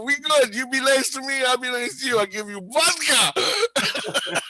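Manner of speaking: high-pitched, over-friendly pidgin English; ingratiating mock Russian. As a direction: plead to be pals in goofy Russian English. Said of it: "trying to get on his good side".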